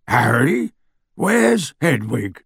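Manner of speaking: slow, big-bodied half-giant voice; Hagrid voice. A narrator's reading. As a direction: voice him slow and heavy.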